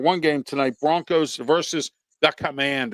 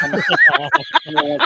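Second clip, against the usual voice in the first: laughing; 13 semitones higher, 5 dB louder than usual. Real, not acted. Laughing while trying to talk.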